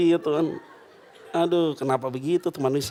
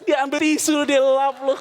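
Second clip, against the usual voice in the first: rising higher and louder to the punchline; punchline build; 9 semitones higher, 4 dB louder than usual. Real, not acted. Preacher delivering the tissue punchline, higher and louder. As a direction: hit the joke hard and high.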